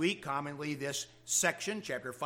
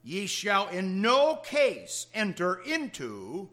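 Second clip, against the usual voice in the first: solemn scripture reading; 4 semitones higher, 5 dB louder than usual. Acted. Reading Jesus' words from scripture.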